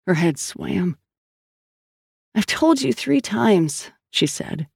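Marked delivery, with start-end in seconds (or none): 2.4-3.9 higher, slow and exasperated; insist, tired of repeating; Sal's voice. A narrator's reading. Sal to the doctor, exasperated and slow.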